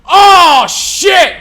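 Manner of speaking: high, loud frightened yelp; loud terrified curse at a jump scare; startled scream. Retold or imitated. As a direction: jump out of your seat. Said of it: "And I went".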